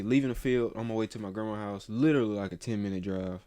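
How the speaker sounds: usual speaking voice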